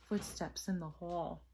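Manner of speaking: ordinary delivery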